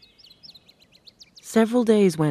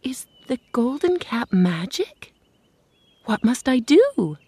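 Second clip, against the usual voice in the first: put-on curious little girl; 6 semitones higher than usual. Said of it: "the girl asked".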